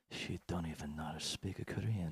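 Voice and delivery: low, soft mock broken-English accent; quiet mocking accent. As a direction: murmur it in a put-on foreign accent. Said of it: "looking at her like".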